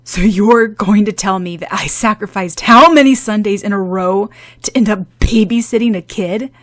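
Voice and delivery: indignant demand. Acted. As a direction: demand she admit the ruined Sunday. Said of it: "Shout-on demanded".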